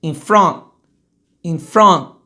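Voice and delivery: "front" loud and stopped at the t; air cut off. Shown as a demonstration. Teacher demonstrating, "front" with no vowel after. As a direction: block the final t of "front". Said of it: "o ar não continua".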